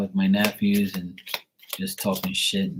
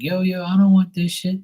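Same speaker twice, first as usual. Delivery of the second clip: high, loud, comic protest; mock refusal; 10 semitones higher, 4 dB louder than usual. Turning down friends' cookies in a high, loud mock protest. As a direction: wave the cookies away, pitched higher and louder. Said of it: "I'm like".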